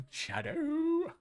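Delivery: SHADOW shooting way up high; goofy high-pitched SHADOW with a long last vowel; silly squeaky repeat. Pretend.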